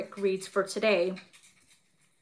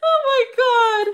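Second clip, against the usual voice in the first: laugh-crying; 13 semitones higher, 8 dB louder than usual. Real, not acted. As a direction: cry it out while laughing helplessly.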